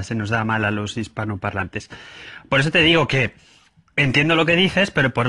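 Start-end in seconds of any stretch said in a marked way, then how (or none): none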